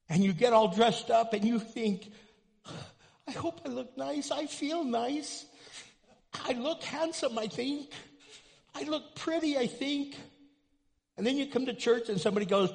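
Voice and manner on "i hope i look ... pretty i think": unsure churchgoer. Retold or imitated. Insecure churchgoer voiced dressing up.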